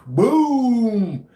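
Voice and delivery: loud, high, drawn-out mock explosion; imitated artillery boom. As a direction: boom it out like a shell landing.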